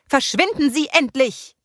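high, loud furious yell; roaring. A narrator's reading. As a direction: bellow at the toad to leave. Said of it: "brüllte sie".

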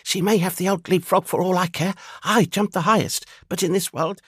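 high, thin flea voice. A narrator's reading. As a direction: squeak it out like a tiny flea. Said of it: "said the flea".

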